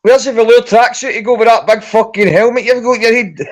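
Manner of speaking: playful Scouse wind-up; Scouse impression. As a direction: rib him in a mock Scouse voice.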